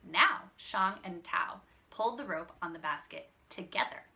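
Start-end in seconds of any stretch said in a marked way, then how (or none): none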